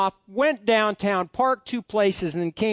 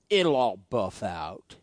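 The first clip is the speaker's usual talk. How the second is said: low, drawn-out teasing catchphrase; slow and low, like a kid needling dad; Micah; 11 semitones lower, slower than usual. Retold or imitated. Son needling his upset dad with his catchphrase, slow low imitation. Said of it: "Micah says this all the time".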